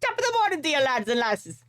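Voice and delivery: high, hammed-up Irish greeting; put-on Irish lilt, starting very high; bad Irish accent. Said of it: "I cannot do a good Irish accent".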